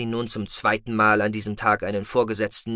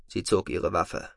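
ordinary delivery

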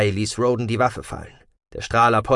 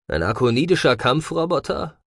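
wondering; 4 semitones higher than usual. Acted. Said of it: "wunderte sich Roden".

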